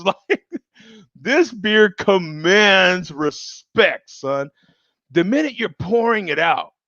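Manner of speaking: ordinary talk, nothing marked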